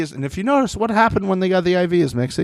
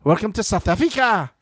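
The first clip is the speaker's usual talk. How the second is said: put-on South African accent; 6 semitones higher than usual. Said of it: "to do the South African accent".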